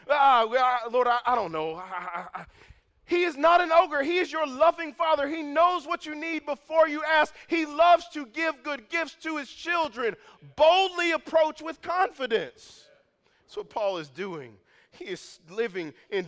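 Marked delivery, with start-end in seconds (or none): 0.1-2.5 louder and higher, hammed-up halting prayer; mock timid stammer. 3.1-12.9 full-force preaching. Acted.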